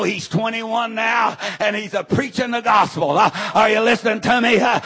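loud, fervent preacher shout; full force preaching. Acted. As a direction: preach it out hard.

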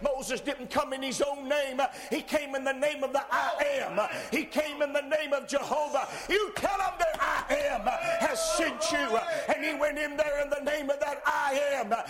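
loud, high, shouted preaching; ringing pulpit shout; full-force preaching. Acted. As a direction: preach it with all you've got.

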